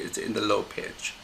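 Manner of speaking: ordinary delivery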